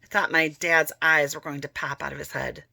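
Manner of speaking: usual speaking voice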